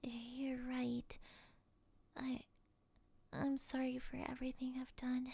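soft, hushed apology; remorseful whisper. Acted. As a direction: whisper it with regret. Captor apologizes under hypnosis.

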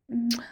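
a small kiss by the ear; little smacking kiss; kiss sound. Acted. Kissing the listener.

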